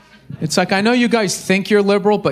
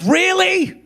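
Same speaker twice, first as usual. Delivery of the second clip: judge them with mock disbelief; incredulous judging; 9 semitones higher than usual. Comedian judging the singing soccer moms, high incredulous jab. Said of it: "Just to be like".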